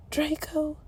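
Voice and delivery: soft hushed calling of his name; quiet. A narrator's reading. Hermione to wounded Draco, hushed. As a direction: breathe his name gently. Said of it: "she said quietly".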